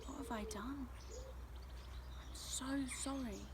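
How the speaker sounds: soft, high, stricken voice; small, hushed apology; remorseful. A narrator's reading. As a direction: realise with dismay what you caused. Tristan regrets the storm damage.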